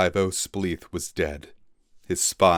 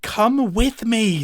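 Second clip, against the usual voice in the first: much higher, slow plea; pleading; 5 dB louder, slower than usual. Acted. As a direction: beg him to come along. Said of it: "Twig pleaded".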